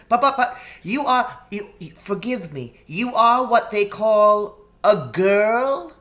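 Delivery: a nervous little faun tripping over words; high excited faun stammer; flustered faun. Acted. Faun stammers on meeting Lucy.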